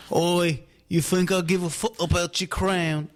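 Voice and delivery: half-sung British taunt; cheeky British accent, lightly sung; mock British accent. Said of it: "your British accent's fucking weird".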